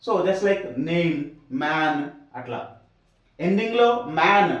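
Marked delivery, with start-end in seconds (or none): 0.0-1.9 very slow, drawn-out "name"; slow demo. Shown as a demonstration. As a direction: stretch "name" and "man" out for the learners. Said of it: "నా sound". (నెమ్మదిగా సాగదీసి "name")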